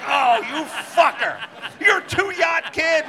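high, loud, laughing disbelief at a rich friend; hammed-up indignant yelling; mock outraged yell. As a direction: shout it like you can't believe it.

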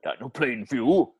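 sing-song Welsh voice; Daniel Plainview gone Welsh; Welsh accent. Acted. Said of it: "That's fucking Welsh".

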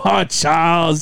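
drawn-out exclamation in imitated Chinese accent; Chinese doctor. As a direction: mimic a Chinese doctor's long-suffering reproach. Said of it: "my Chinese".

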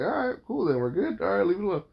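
high, mock-breezy brush-off; dismissive brush-off. Brushing off his wife's backpedal, mock dismissive. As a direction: wave it off and close the topic.

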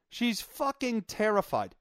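usual speaking voice

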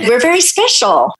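high, hammy pride; playfully proud. Acted. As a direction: proclaim it playfully.

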